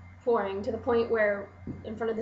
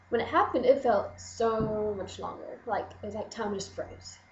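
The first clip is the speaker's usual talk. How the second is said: ordinary delivery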